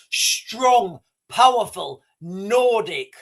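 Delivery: slow, emphatic admiration; weighty, separated words, slowly relished; savouring with relish. Real, not acted. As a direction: draw out each word with mock grandeur. Relishing a Norwegian general's name.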